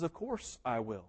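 soft, slow, low reassurance; tender assurance. Retold or imitated. Aslan reassuring Lucy, soft and slow. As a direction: promise it gently. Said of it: "And he says".

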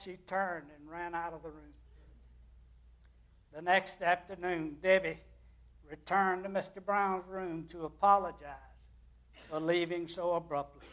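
normal talking voice

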